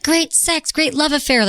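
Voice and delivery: high, mock dreamy gush. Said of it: "I'm like".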